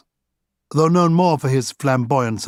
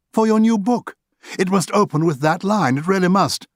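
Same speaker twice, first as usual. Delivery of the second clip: higher, insistent genial voice; lightly voiced Oscar; 8 semitones higher than usual. Oscar pressing Arthur to start the book, higher and insistent. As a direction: coax him warmly but grandly.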